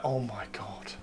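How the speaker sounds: very soft, low aside; hushed reaction. Real, not acted. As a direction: drop almost to nothing, low and under the breath. Reacting to the imagined villa.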